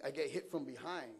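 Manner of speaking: ordinary delivery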